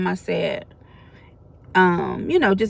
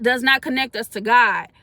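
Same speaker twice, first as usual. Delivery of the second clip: normal talking voice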